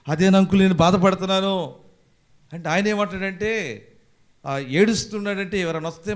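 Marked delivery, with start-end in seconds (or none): 0.1-1.7 high, loud plaintive cry; acted lament. Youth telling uncle why he grieves, high acted lament. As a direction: wail it in mock distress.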